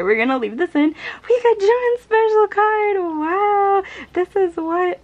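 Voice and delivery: loud, high, breathless with excitement; can barely contain herself; real excitement. Real, not acted. Fan just pulled [name]'s card, loud and thrilled. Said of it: "I'm, like, shaking".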